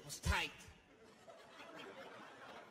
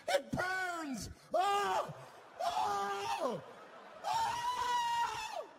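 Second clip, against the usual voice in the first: rising howls, higher and higher; pained screaming; 13 semitones higher, 3 dB louder, much slower than usual. Retold or imitated. Splattered passenger howling in pain, rising shrieks. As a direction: scream like it burns your skin. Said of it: "This guy was freaking out".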